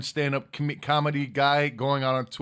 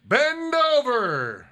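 wrestling promo; 8 semitones higher, 4 dB louder, much slower than usual. Acted. Mock command, drawn out.

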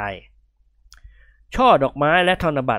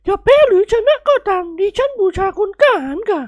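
very high, louder falsetto matron; miffed plump lady; 5 dB louder than usual. Acted. Lady competing to host the heroes, miffed falsetto. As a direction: gush and insist in a put-on woman's voice. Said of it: "สุภาพสตรีอ้วนจ้ำม่ำค้อนท่านขุนขวับแล้วกล่าวขึ้น".

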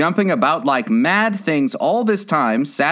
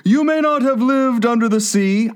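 high, mournful turtle character voice; high-voiced tearful storybook turtle; tearful Mock Turtle; 11 semitones higher than usual. A narrator's reading. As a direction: play a weepy creature, pitched well up. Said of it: "with tears running down his cheeks".

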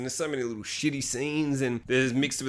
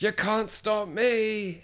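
higher, very slow defiant line; defiant hero; 8 semitones higher, much slower than usual. Retold or imitated. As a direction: drag out each word in defiance, higher. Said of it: "he's like".

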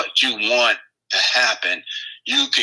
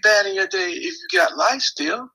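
high rhetorical; 11 semitones higher than usual. Real, not acted. Guest challenging rhetorically.

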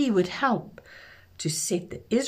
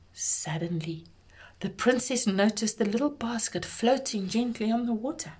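usual speaking voice

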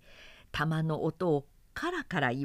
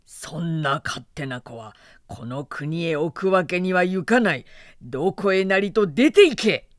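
louder, furious banishment; father god orders his son out in a rage, loud and furious; angry father god; 7 dB louder than usual. Acted. Father god banishing his son, angry shout. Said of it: "大層お腹立ちになって".

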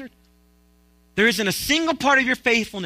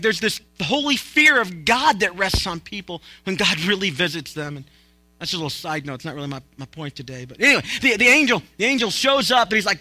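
ordinary talk, nothing marked

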